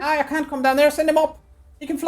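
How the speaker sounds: very high, shouted cry from aloft; crow's nest lookout. Acted. Fellow high in the crow's nest to those on deck, high-pitched cry out. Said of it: "there is a fellow in the crow's nest".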